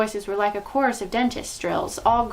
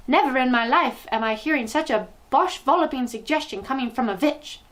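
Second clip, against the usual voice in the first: scolding rage; 5 semitones higher than usual. A narrator's reading. Witch berates foolish witch.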